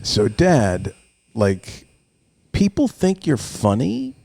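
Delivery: put-on incredulous kid's question, rising high at the end; slow, mock-puzzled disbelief; imitating skeptical son. Twelve-year-old asks dad about being funny, skeptical.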